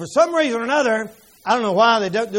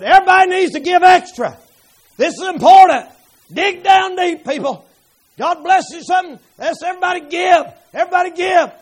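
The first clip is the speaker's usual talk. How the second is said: high, loud fundraising call; rally the church to give; urgent appeal; 7 semitones higher, 6 dB louder than usual. Retold or imitated. Pastor urges church to give, loud.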